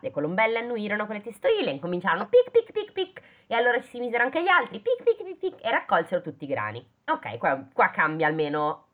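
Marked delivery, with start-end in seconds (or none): 2.5-3.2 high, quick pic pic pic like little birds pecking; high birdy pecking sounds; pecking noises. Retold or imitated. 4.8-5.4 high, quick pic pic pic like little birds pecking; high birdy pecking sounds; pecking noises. Retold or imitated. More birds join pecking.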